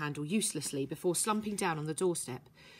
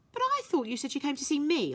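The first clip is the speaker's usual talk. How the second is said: higher, whiny complaint; 8 semitones higher than usual. Acted. Herbie to Violet, hurt she came for Eels, whiny. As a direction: whine a little, feeling hurt. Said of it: "the whiny tone it brings to my voice".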